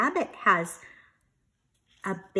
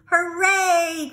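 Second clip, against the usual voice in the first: higher, louder hooray; cheering; 9 semitones higher, 8 dB louder than usual. Acted. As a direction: cheer like happy kids. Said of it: "cried Franklin and Bear".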